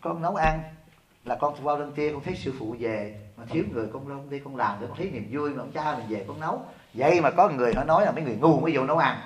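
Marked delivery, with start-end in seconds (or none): 0.0-6.6 quoted disciple, soft. Retold or imitated. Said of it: "Khổ cái gì?".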